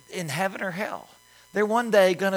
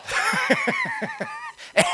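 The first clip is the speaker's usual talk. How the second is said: burst of laughter. Real, not acted. Laughing at own punchline.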